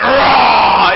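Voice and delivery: loud mock monster roar; scary monster roar. He went up to the girl, roaring playfully. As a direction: roar loudly to frighten a child.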